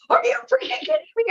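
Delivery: imitating her own panic on takeoff, high and quick; freaking out. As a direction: yelp it in disbelief, pitch way up. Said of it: "freaking out".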